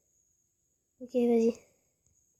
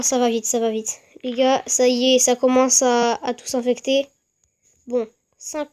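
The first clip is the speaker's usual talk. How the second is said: ordinary talk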